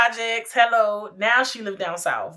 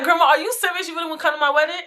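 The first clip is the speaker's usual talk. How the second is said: raised, higher-pitched retold disbelief; incredulous question; 7 semitones higher, much faster than usual. Granddaughter questioning grandma about her wedding, incredulous. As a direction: ask it high, in mock disbelief.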